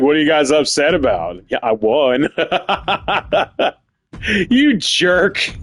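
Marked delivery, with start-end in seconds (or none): 0.0-2.3 lower, smug mock voice with a laugh in it; mocking smug wrestler. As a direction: play the gloating winner, lower. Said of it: "he's just saying, like".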